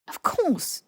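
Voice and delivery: defensive answer to Hermione's question. A narrator's reading. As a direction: snap back a little too fast.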